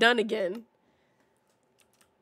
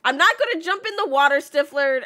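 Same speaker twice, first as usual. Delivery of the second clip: excited; 3 semitones higher, 8 dB louder than usual. Real, not acted. Teasing friend about the water.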